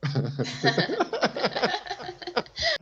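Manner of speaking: a real burst of high laughter; laughing aloud. Real, not acted. Teacher laughing at a student's reply, real high laugh.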